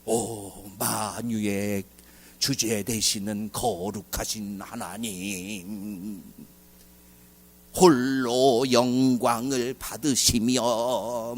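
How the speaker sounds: very slow, soft, pompous prayer voice; mocking a stiff, ceremonious prayer, drawn out slowly; stiffly solemn prayer. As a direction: intone it like an overly formal liturgy. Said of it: "무슨 점잖은 막 그런".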